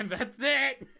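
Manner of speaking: high, loud exclamation; loud echo. Real, not acted. Co-host echoing the friend's sign-off, high loud exclamation. Loudly echoing the other speaker.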